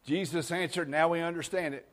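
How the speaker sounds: ordinary delivery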